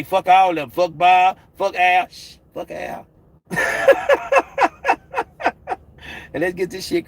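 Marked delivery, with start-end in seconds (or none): 0.0-2.1 mock shout. Loud mock promoter rant. 2.1-3.1 hushed whisper; whispered aside. Retold or imitated. Whispered after shh.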